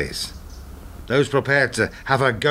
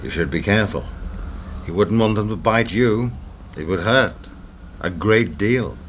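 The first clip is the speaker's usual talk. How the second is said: veiled threat; 6 semitones lower than usual. Acted.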